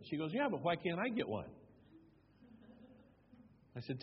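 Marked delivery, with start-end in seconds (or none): 0.3-1.5 pitched up, pushing back like a spoiled adult; insistent whine. Retold or imitated. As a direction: whine it higher, not taking no. Woman insists on getting name.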